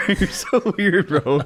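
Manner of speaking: laughing through the words. Real, not acted. Host laughing at his friend's odd hello, laughing. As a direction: crack up at your friend.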